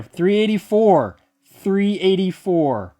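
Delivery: loud, high and drawn out; real exultant call; triumphant. Real, not acted. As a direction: proclaim the winning number slowly. Triumphantly reads out the confirmed number.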